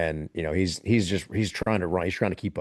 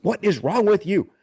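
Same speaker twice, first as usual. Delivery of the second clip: loud, high demand; retold shout of frantic exasperation; mock exasperated yell; 12 semitones higher, 7 dB louder than usual. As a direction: imitate shouting while shaking someone. Retold shaking him and demanding answer.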